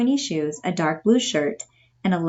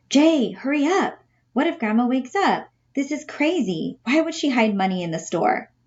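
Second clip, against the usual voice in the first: put-on higher, urgent boy's voice; higher, anxious whispered-style urgency; nervous Artie; 6 semitones higher than usual. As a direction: hurry him up, scared of waking Grandma.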